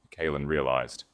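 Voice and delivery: plain reading voice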